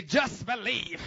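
loud, high southern accent; exaggerated southern twang. Shown as a demonstration. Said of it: "do it with a southern accent".

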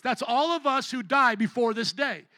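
ordinary talk

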